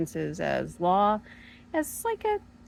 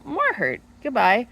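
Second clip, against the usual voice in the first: curt dismissal; 6 semitones higher, 6 dB louder than usual. Retold or imitated. Mock dismissive reply to husband.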